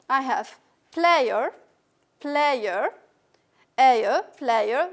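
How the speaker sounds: player drawn out slowly, ending in a schwa; slow modelled pronunciation. Shown as a demonstration. Teacher modelling player for the class. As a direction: stretch out player slowly and loudly. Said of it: "what's the rule here?".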